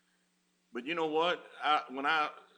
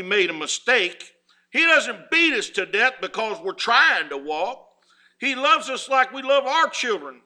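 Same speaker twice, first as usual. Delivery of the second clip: full-force preaching; 10 semitones higher, 12 dB louder than usual. Acted. Preacher to his flock, preaching at full force. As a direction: preach it out to the whole congregation.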